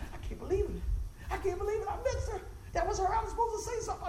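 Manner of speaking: worked-up, high-pitched retelling; high excited voice. Retold or imitated. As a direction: voice climbing very high. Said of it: "My voice get high when the Lord's talking".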